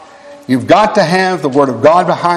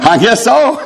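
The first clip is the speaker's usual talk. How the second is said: laughing reply; 7 semitones higher than usual. Retold or imitated. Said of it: "I said".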